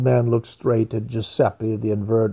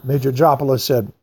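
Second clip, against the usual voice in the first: plain reading voice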